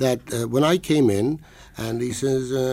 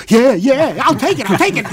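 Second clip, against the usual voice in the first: much louder, higher eager blurt; eager yes; 12 semitones higher, 10 dB louder than usual. Retold or imitated. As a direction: jump at the offer, can't wait. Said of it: "I was tempted to say".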